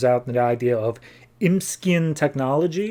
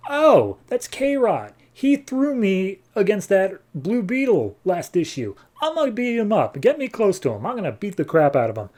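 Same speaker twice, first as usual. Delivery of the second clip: high, agitated retold outburst; mock-eager voice itching for a fight; worked-up threat; 7 semitones higher than usual. Jediah to ally on spotting an old enemy, worked-up threat.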